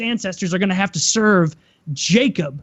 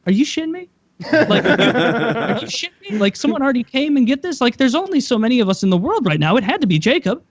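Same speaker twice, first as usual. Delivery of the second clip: higher-pitched comic disbelief; incredulous outburst; 4 semitones higher than usual. Retold or imitated. Cheated son reasoning with his father about Jacob, incredulous. Retold Esau outraged at the trick.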